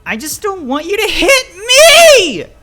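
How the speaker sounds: very high, drawn-out tearful scream; shrieking scream. A narrator's reading. Child begging father not to hit, shrieking. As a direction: let the yell break into a shriek. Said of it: "voice shifts to a shrieking scream".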